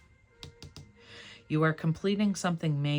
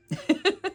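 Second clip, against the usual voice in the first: high burst of laughter; 6 dB louder than usual. Real, not acted. Laughs at own advice.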